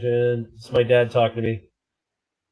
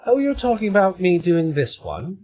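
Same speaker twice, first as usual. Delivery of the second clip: put-on 1 British accent; 7 semitones higher than usual. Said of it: "British accent".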